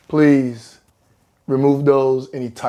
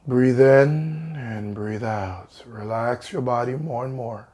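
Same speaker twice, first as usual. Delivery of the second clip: guided meditation. Acted. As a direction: ease them down slowly, breath by breath. Leads a guided relaxation.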